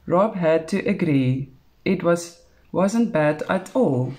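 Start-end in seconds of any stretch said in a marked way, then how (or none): none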